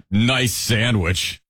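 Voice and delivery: very slow, drawn-out sarcastic compliment; obvious, dragged-out sarcasm; good sarcasm. Shown as a demonstration. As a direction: stretch out the fake praise. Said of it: "good sarcasm is like".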